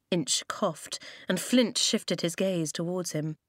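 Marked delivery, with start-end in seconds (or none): none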